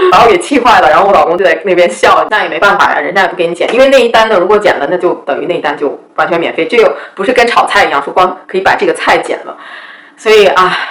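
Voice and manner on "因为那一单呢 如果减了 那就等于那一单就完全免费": ordinary talk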